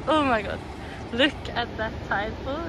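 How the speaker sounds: usual speaking voice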